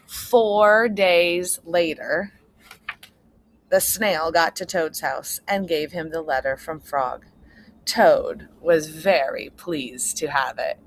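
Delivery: ordinary delivery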